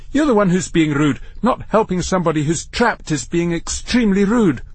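high, indignant scolding; seething. A narrator's reading. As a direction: throw the accusation back, seething. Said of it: "seething now".

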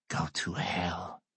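slow, hissed sneering whisper. Acted. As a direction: spit it out in a low whisper. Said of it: "Fonz sneers".

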